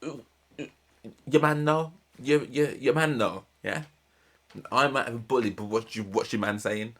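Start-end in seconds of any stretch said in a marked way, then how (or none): 0.0-3.8 mock taunt. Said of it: "my mouth would have been fixed to be like".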